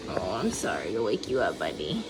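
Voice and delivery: soft, low apologetic coo. Real, not acted. Waking her son gently.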